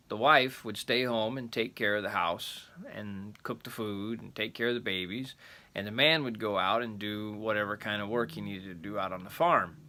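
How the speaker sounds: ordinary delivery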